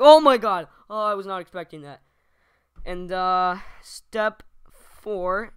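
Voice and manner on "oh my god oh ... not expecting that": sudden shriek, then quick fake shock; fake scream of surprise. Shown as a demonstration. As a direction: overplay the jump scare. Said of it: "like, scream, like".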